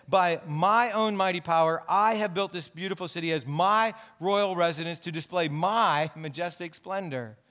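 "my" and "I" hit hard each time in "By my own mighty power, I have built"; stress on I and MY. Shown as a demonstration. Preacher showing a king's pride to the congregation, stressing "I" and "my". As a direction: lean heavily on every "my" and "I", slowing into "my majestic splendor". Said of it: "uses the words "I" and "my" four times".

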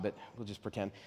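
ordinary talk, nothing marked

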